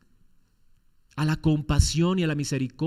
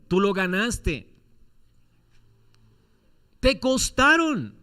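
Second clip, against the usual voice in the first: higher and louder pleading for the people; impassioned preaching; 6 semitones higher, 5 dB louder than usual. Acted. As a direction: plead like Moses before God. Voicing Moses' plea to God.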